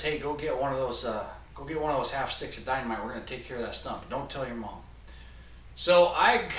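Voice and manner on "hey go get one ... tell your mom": hushed secret. Retold or imitated. Dad secretly plans dynamite.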